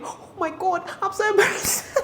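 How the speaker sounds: very high squeal breaking into laughter; hammed-up mortified squeal; embarrassed. Girl learning friends think she likes them, embarrassed. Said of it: "I was so embarrassed".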